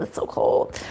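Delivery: whispered under her breath; whispered complaint. Real, not acted. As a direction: murmur it half to herself.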